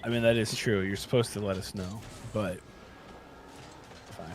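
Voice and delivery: ordinary delivery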